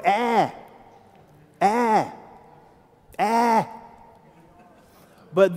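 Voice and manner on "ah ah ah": "ah" held open with no r; mock Boston "ah", pitched up a bit; mimicked Boston vowel. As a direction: exaggerate the Boston "ah", each one separate. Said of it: "We don't go".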